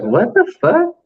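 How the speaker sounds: high-pitched incredulous question; retold bafflement. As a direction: act out baffled disbelief. Retelling his baffled reaction.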